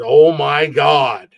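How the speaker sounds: loud, drawn-out disbelief; stunned awe. Retold or imitated. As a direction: stretch it out in loud amazement. Drunk guy astonished to see him.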